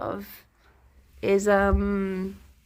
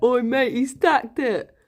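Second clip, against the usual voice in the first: raised, punchy Hertfordshire playground call; Hertfordshire accent; 4 semitones higher, 4 dB louder than usual. Retold or imitated. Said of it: "That is the beautiful Hertfordshire accent there".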